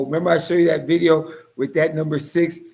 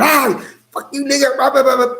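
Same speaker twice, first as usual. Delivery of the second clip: high-pitched imitated ranting; mock rant; 9 semitones higher than usual. Imitating provokers wanting a reaction, high mock rant. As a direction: jabber it high like a mock tirade. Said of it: "They're going".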